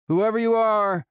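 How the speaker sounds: high, loud call out; guard shouting toward the attackers. A narrator's reading. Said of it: "The taller one raises his voice".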